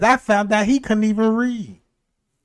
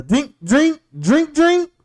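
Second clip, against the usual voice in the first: mock broken English; 6 semitones higher, 5 dB louder, much slower than usual. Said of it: "this how he talk".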